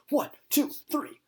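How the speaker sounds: high, urgent mock count; counting compressions. [name] counting CPR on the sock puppet, high and urgent. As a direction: count it out like a panicked medic.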